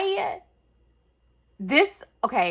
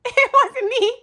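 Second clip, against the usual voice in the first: high, loud, giggly voice; giggling, higher playful denial; laughing denial; 8 dB louder than usual. Real, not acted. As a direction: laugh while protesting innocence. Giggly denial of posting hauls.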